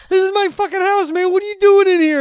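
high, loud outraged yelling; hammed-up furious shouting; imitated angry homeowner. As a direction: yell as a man defending his house. Angry homeowner yells back in story.

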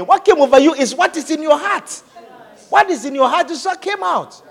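excited rising preaching. Real, not acted. Preacher rises in pitch.

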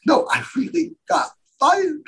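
high-pitched mock tearful wail; imitated crying. Telling his wife he really got fired, imitated crying. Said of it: "I started crying".

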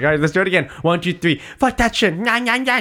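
put-on high childish voice throughout; high mock toddler swearing; kid voice. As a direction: play the little kid mimicking. Dad and kid retold.

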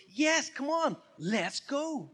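loud, high, eager cheer; excited agreement. Acted. Person answering "let's go", excited and loud. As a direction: leap at the invitation, high and loud. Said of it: "loaded with excitement".

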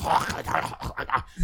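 throaty "hah-kah-hah" imitation of German; harsh German noises. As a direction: mimic German with guttural nonsense syllables. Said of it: "why does it sound".

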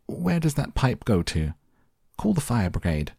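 high question easing into a demand; mild, storybook yell for help. A narrator's reading. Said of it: "yelled Missus Gloop".

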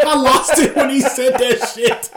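high, slow words squeezed through laughter; real laughing. Real, not acted. Telling co-host about funniest sketch line, laughing hard. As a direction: talk while cracking up. Cracking up at retold joke.

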